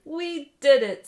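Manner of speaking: loud, slow triumphant shout; triumphant cheer. Acted. Jabari celebrating with his partner, loud triumphant cheer. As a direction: savour the win.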